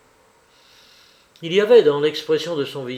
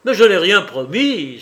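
loud, higher exclamation; indignant cry; 5 semitones higher, 9 dB louder than usual. A narrator's reading. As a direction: cry out in angry denial. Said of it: "s'écria-t-il".

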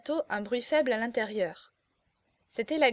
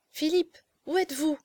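higher, anxious calling; worried, searching plea in a raised pitch; frightened young woman; 5 semitones higher than usual. A narrator's reading. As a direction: call for your lover in fear. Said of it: "pâle et brisée par l'épouvante".

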